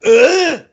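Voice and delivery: loud, high imitated grunt; Big Show's grunt. Wrestler signalling the crowd before a chop, imitated loud grunt. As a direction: grunt wordlessly like Big Show. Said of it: "the only way he can communicate".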